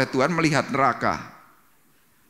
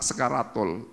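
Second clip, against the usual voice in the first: normal talking voice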